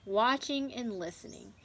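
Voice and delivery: put-on lively storybook narration for young listeners; reading to children. A narrator's reading. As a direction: read it like a picture book to a class. Teacher reads a farm story aloud.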